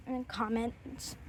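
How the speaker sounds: slow, breathy whispered aside; a hushed, conspiratorial Australian-accent whisper. Real, not acted. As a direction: slip it in as a secret whisper. Sneaky aside about bad words.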